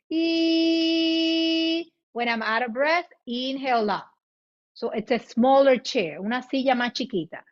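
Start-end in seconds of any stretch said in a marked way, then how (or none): 0.1-1.9 long, higher-pitched ee; sustained "ee". Shown as a demonstration. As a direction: hold ee steadily on one high note. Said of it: "continue with the E".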